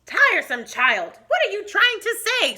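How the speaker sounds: a touch of the irritated mother, rising high on the question; impatient mother. Acted. Mother losing patience with her son, mildly sharp. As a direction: scold him lightly. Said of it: "said his mother".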